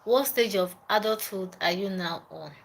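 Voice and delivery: ordinary talk, nothing marked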